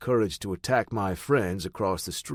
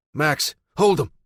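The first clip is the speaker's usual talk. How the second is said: firm command; 6 semitones higher, 7 dB louder than usual. Acted. Commanding the dog.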